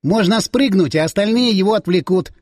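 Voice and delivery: lightly voiced squeaky suggestion; mild squeak. A narrator's reading. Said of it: "вдруг пискнул Соколов".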